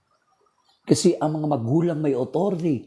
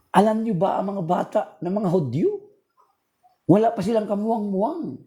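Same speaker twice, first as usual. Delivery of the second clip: fiery preaching; 7 semitones higher than usual. Acted. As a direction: preach it out to the whole church. Preaching to the congregation.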